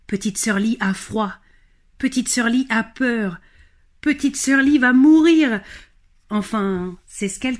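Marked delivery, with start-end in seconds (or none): 2.0-5.6 higher, louder frightened cry; narrator's peak of fear; rising dread. A narrator's reading. Narrator voices Lee's fear of the dragon, louder. Climax of danger.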